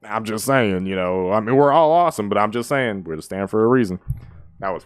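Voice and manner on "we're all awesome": excited. Real, not acted. Hyped quote of the rap.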